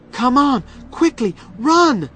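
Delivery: high, loud urgent command; urgent call. A narrator's reading. Tom urging Becky to flee the bats, urgent shout.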